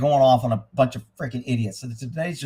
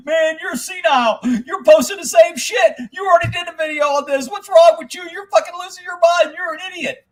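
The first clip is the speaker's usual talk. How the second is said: high-pitched, shouty rant imitating complainers; mocking bitching commenters; 5 dB louder than usual. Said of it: "I'll have everybody bitching".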